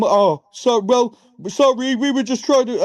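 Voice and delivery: high-pitched, pleading mock apology; put-on apologetic excuse, high. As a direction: imitate sheepish attackers backpedalling, pitched well up. Attackers' acted apology after missing.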